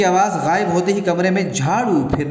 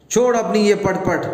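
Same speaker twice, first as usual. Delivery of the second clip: put-on higher, furious threat at the child; angry threat; 6 semitones higher than usual. Wife storms out to stop her son, angry threat. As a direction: snap at the boy to stop. Mother scolds crying child.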